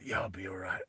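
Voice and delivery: a hurt man's quiet reassurance; weak reply. Retold or imitated. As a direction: push it out through the pain. Injured man answering.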